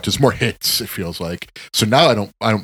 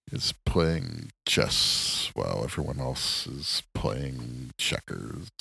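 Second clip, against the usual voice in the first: low, very slow, hushed impression; Robert Kraft; 7 semitones lower, 9 dB quieter, much slower than usual. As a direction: impersonate a man talking softly and ponderously. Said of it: "Robert Kraft impression".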